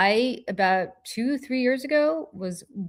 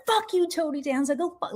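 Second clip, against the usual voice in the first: much higher angry yell, fading quickly; furious yell; 10 semitones higher than usual. Retold or imitated. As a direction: shout after him as he runs. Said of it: "And I'm like".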